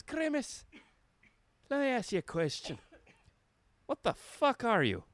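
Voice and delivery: put-on high-pitched drunken Scottish rant, slurred and incredulous; drunk Ronald McDonald. Drunk Scottish clown confronting Grimace, high indignant slur. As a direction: play a drunk Scottish clown picking a fight. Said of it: "He's drunk on this".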